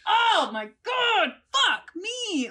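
drawn-out, high-pitched cursing wail; mock scream. Acting out the moment after a stubbed toe, mock scream. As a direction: stretch the curse out high, as if in pain. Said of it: "and you scream, like".

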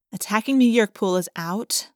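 ordinary delivery

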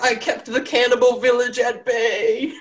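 high, slow giant wail; protesting giant. Acted. Fallen giant defends himself to the group, high drawn-out protest. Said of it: "The Giant's just like".

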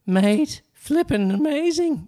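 very high, loud British accent impression; Paul McGee. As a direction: exclaim it in a drawn-out, hammed-up British accent. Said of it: "Can you do it in his accent?".

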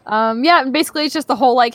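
ordinary talk, nothing marked

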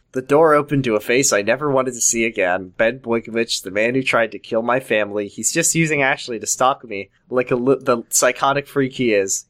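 plain reading voice

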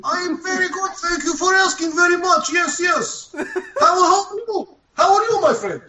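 hammed-up polite gentleman; put-on polite voice. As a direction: answer like an overly courteous foreigner. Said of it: "do this voice for the whole night".

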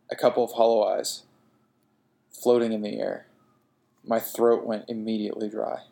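ordinary delivery, nothing marked

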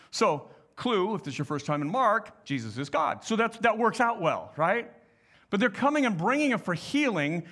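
normal talking voice

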